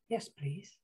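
very soft, shy acceptance; quiet little child's answer; small grateful child. A narrator's reading. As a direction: say it small and hushed. Child meekly accepts mother's offer.

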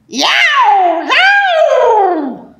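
high loud cat yowl; tomcat yowl. Acted. Tomcat noise in picture book.

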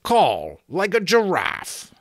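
slow, loud, incredulous outburst; swearing giant. Acted. Giant scorning the absurd new password, loud swearing outburst. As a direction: repeat it slowly in outraged disbelief. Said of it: "the giant swore".

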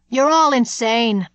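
loud, slow furious shout; ranting shout. Acted. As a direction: hurl it at them in a rage. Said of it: "Dooley stood ranting at them".

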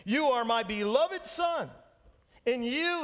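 lightly booming heavenly voice, drawn out; booming voice of God. Retold or imitated. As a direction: proclaim it like the voice from heaven. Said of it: "God says in this great voice".

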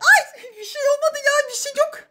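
high, hurried-to-reassure denial; flustered denial. Acted. Wife denying anything happened to husband, flustered and high.

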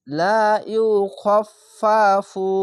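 very slow লা ইউখাফফাফু, each ফ sounded distinctly; drawn-out recitation. Shown as a demonstration. Teacher showing how ফা is repeated, slow and distinct. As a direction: hold লা long and dwell on the doubled ফ in ইউখাফফাফু. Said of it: "এরকমভাবে".

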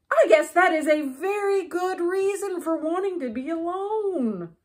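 a hint of relieved Toad. A narrator's reading. Toad accepting Frog's reason, lightly voiced relief.

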